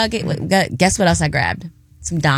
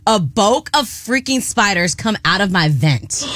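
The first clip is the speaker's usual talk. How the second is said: ordinary talk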